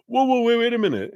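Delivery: high, loud startled protest; alarmed whoa. Retold or imitated. C P stopping F S's fast changes, high alarm. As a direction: pull them up short, alarmed. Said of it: "And they're like".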